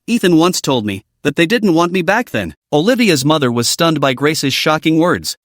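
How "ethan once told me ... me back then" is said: usual speaking voice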